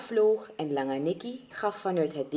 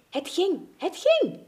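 very high, thrilled exclamation; elated; 11 semitones higher than usual. Acted. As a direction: exclaim in delight, second time higher still. First successful flight.

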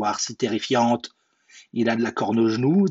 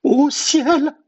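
very high, loud snake's cry; alarmed exclamation; 7 dB louder than usual. A narrator's reading. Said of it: "s'exclama-t-il".

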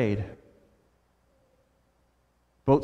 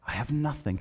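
soft solemn close; 3 semitones lower, 8 dB quieter than usual. Acted. Jesus repeats, softer.

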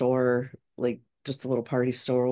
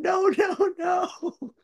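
panicked shout; much slower than usual. Retold or imitated. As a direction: yell in panic as the car reverses. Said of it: "I'm laying on the ground going".

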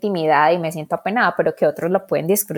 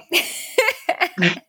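high laugh; 12 semitones higher than usual. Real, not acted. Laughs at co-host's teasing.

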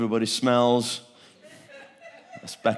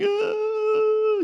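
mimicked crying gasp; 4 dB louder than usual. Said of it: "The girl's sitting there crying".

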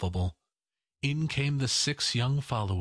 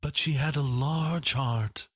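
lightly voiced ghost, pitched up; a touch higher, as the ghost; 5 semitones higher than usual. A narrator's reading. Ghost remembering the sister, in a lightly higher ghost voice. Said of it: "said the ghost".

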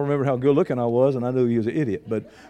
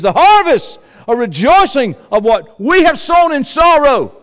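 full-force preaching; 10 semitones higher, 10 dB louder than usual. Acted. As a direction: belt it out to the congregation.

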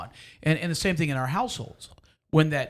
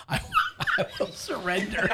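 laughing; 7 semitones higher than usual. Real, not acted. Joking about the failing train.

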